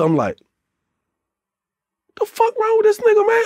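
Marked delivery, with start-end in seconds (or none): 2.2-3.4 high, disbelieving squeak; a high, stunned what-is-wrong-with-him; incredulous. Retold or imitated. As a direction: pitch way up in shocked disbelief. Shocked aside during Block's tirade.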